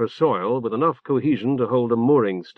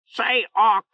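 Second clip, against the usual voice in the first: much higher, shouted bird cry; squawking bird call; 10 semitones higher than usual. A narrator's reading. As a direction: call out like a parrot. Talking bird calls Orc.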